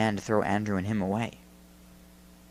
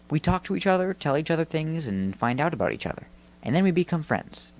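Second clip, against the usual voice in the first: higher-pitched, earnest character voice; friendly Jake, explaining; 6 semitones higher than usual. A narrator's reading. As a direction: explain it simply to a new friend.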